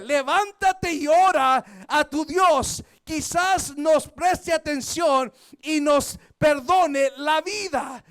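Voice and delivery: loud, slow urgent order; shouting captain. Retold or imitated. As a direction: command him to wake and pray. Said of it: "Le gritó". (capitán ordena a Jonás a gritos)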